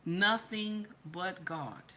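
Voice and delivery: NOTHING BUT GOD drawn out at half speed; slow emphatic repeat. Real, not acted.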